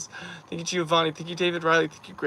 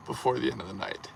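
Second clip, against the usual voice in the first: usual speaking voice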